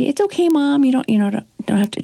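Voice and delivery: lightly voiced daughter soothing her mom. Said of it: "my daughter would say".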